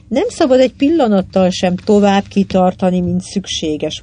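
plain reading voice

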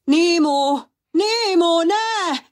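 nagyon magas, hangos kiáltás; üvölts a távolodó csónak után; kétségbeesett kiabálás. Acted. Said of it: "kiabált Pizsi". (Pizsi kétségbeesetten kiabál az elvitt fia után)

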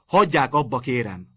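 loud, insistent plea to stop; loud demand. A narrator's reading. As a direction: cut through the piano noise. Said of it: "mondta hangosan".

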